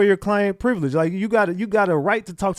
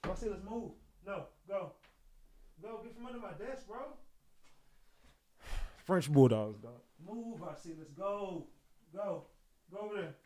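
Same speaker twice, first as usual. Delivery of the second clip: hushed scolding; 18 dB quieter than usual. Real, not acted. Streamer quietly shooing his smelly dog from under the desk, hushed scolding. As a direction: quietly shoo the dog away.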